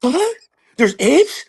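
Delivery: high, questioning tough-guy impression; short high bursts, each tipped up like a question; Mark Wahlberg. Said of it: "But Mark Wahlberg".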